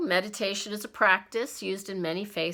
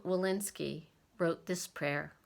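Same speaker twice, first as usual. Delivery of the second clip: ordinary talk, nothing marked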